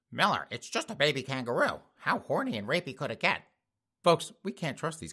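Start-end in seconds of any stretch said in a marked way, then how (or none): 0.1-3.4 doubting listener. Retold or imitated. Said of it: "I know you folks are like".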